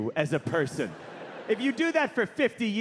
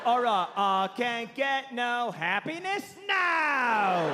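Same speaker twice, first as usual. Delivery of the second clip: slow, higher rock-star drawl ending in a long howl; exaggerated British rocker wailing his rejection; 5 semitones higher, much slower than usual. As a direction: compose aloud, stretching each word. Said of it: "Just one word at a time".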